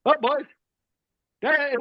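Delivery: high-pitched mock-British soldier; British accent. Retelling the British soldier's line, mock British accent. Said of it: "you know, the British accent".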